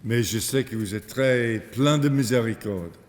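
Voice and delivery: English speaker's French accent; careful French with an English accent; foreign accent in french. Real, not acted. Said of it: "mon français est devenu très faible".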